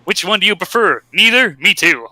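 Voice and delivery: high, playful questions; lightly mock a cheesy wink to the audience; hammy closing aside. Acting the comic's last joke to readers, hammy aside.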